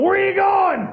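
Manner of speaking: put-on high yelled drunk plea; drunk shout. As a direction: holler after the departing women. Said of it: "he's going".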